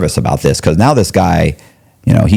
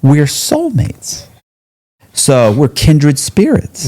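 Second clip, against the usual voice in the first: much higher, slow, sarcastic wounded tone; mock hurt; 9 semitones higher, much slower than usual. Inmate sarcastically pretends Ron hurt his feelings. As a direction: play it like a betrayed friend, tongue in cheek. Mocking Ron's distrust.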